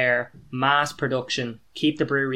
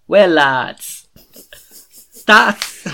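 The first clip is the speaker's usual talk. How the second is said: put-on a cut-off first try at a broad Westmeath accent, louder; Walshy's Westmeath accent; 5 semitones higher, 11 dB louder, much slower than usual. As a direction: Westmeath accent with long vowels, broken off by laughter. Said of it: "do your best Walshy impression for Westmeath".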